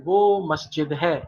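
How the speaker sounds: slow, clear classroom prompt; unhurried teacher question. Shown as a demonstration. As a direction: spell it out slowly for the class. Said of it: "جلدی سے بتائیے".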